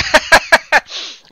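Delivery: loud, high burst of laughter; big high-pitched cackling laugh. Real, not acted. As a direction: crack up out loud. Cracking up at a friend's joke.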